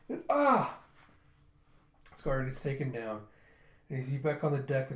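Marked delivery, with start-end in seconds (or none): 0.3-0.8 loud, high-pitched acted yell; pained cry. Guard taken down from behind, pained cry.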